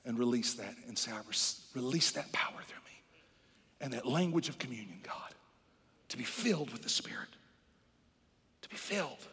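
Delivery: soft, half-whispered prayerful appeal; hushed reverence. Real, not acted. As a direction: drop to a reverent near-whisper.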